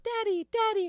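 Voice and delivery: very high, loud falsetto; mock sex robot. Co-host mimics the robot interrupting couch time, high loud falsetto. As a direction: blurt it out as the nagging robot.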